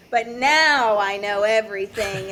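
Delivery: mock know-it-all, drawn out and bold; cocky. Diver bouncing back from a humbling, mock cocky. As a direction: play up a reinflated ego on a stretched NOW. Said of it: "they'll get that humbling".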